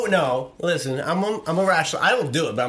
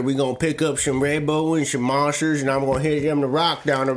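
put-on rambling country-boy accent; country good old boy; 3 semitones lower than usual. Plays a country character.

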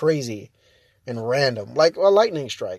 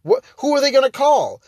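rising to higher, louder disbelief; incredulous retort; 6 semitones higher, 5 dB louder than usual. Retold or imitated. Vlogger retorting to a Karen, incredulous and loud. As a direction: scoff at the threat. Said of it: "I'm like".

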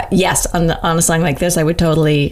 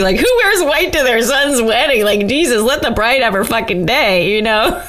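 1 indignant; 6 semitones higher than usual. Real, not acted. Indignant about ex at wedding.

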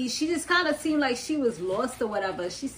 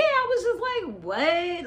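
baffled reaction; 8 semitones higher than usual. Retold or imitated. Recounts her baffled reaction.

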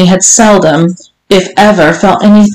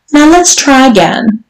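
higher, coaxing schoolmistress lilt; prompting; 7 semitones higher than usual. Acted. Mistress to her servant, prompting from on high. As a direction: nudge him to have another go. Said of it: "Missus Johnson prompted".

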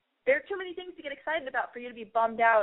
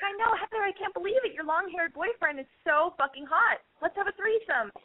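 put-on high, giddy girly squeal; giddy high gush; 9 semitones higher than usual. Meeting her friend years later, high giddy gush. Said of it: "I'm gonna be like".

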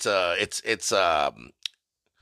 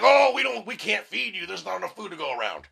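pitched-up mocking impression of someone refusing; mock excuse-making; 6 semitones higher than usual. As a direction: play a put-upon excuse in a high voice. Mocking people who refuse to share food.

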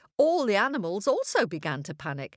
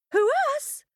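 much higher tiny-creature voice; squeaky innocent; 4 dB louder than usual. A narrator's reading. Small animals deny.

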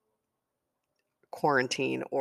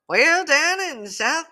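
imitating a Southeastern Kentucky accent, high and slow; drawn-out Kentucky storyteller accent; Myra Calder's Kentucky accent; 13 semitones higher, 9 dB louder, slower than usual. Colleague from Kentucky telling stories, mock Kentucky accent. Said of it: "I can't do her accent".

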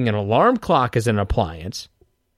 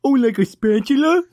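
much higher silly teasing voice; stupid voice; 11 semitones higher than usual. Acted. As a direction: squeak it out to annoy your friend. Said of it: "I fucking hate that".

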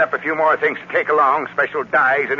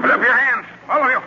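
higher-pitched armed order; sharp command; 6 semitones higher than usual. Acted. Armed man to intruders in his room, commanding. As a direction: order everyone to surrender. Gunman surprises them with orders.

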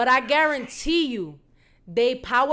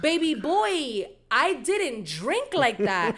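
slow, drawn-out offended comeback; indignant retort; 3 semitones higher, much slower than usual. Retold or imitated. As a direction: stretch every word in sassy disbelief. Indignant retort to being called drunk.

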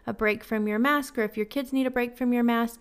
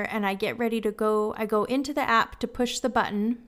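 normal talking voice

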